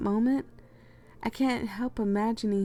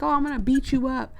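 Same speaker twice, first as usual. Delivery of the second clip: higher, louder mock threat; imitated yelling girl; 6 semitones higher, 6 dB louder than usual. Reader imitating a girl who is yelling at her, mock yell. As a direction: act out a girl's yelled threat. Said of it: "a girl is yelling at me".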